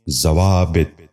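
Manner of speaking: ضوابط ending in "-bit", "za-waa-bit"; correct pronunciation. Shown as a demonstration. Teacher giving viewers the model, correct pronunciation. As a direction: say ضوابط as zawaabit. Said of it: "دوبارہ سنیے".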